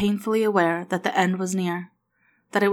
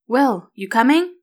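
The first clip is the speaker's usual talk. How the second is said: louder shout across the yard; calling from the yard; 5 semitones higher, 6 dB louder than usual. A narrator's reading. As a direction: call out to a friend. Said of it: "Riley called from the yard".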